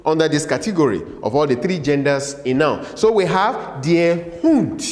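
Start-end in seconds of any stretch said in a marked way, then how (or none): 3.8-4.8 slow, separated "der Hund"; teacher modelling German; model pronunciation. Shown as a demonstration. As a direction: say it carefully for learners to repeat. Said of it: "Say it after me".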